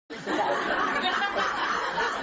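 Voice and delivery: amused laugh. Real, not acted. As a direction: open breathy laugh. Audience laughs at rabbi joke.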